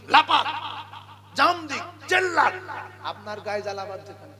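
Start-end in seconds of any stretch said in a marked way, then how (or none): 0.1-2.5 higher, punchy bursts; mock shouting. Preacher acting out uproar over children in mosque, mock shouts. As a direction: act out a rowdy outcry.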